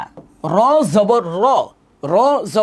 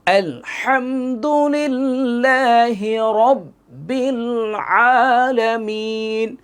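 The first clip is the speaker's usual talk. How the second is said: slow drawn-out recitation; Quran recitation; 3 semitones higher, much slower than usual. Shown as a demonstration. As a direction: chant it slowly, stretching the vowels. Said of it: "recitation demo".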